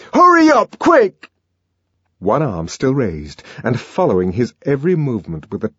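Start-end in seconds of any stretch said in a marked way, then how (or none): 0.1-1.3 loud, high urgent shout; raging, impatient command; furious order. Acted. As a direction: drive someone out with a yell. Savage orders her out in rage.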